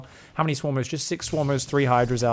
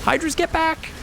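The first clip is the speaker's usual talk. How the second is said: urgent yell; 13 semitones higher, slower than usual. Real, not acted. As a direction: shout the retreat order. Urgent call to retreating units.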